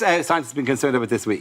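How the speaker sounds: usual speaking voice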